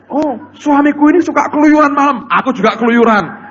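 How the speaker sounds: high loud silly wife's voice; mocking a foolish wife. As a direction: ham up her excuse. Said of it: "Jangan terus berkata". (meniru istri konyol dengan suara tinggi dan keras)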